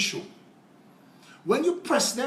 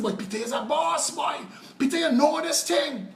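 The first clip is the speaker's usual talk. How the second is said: higher, excited exclaiming; impressed coworkers; 7 semitones higher than usual. Retold or imitated. Workers praise the boss mechanic, excited and higher. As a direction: gush like amazed workmates.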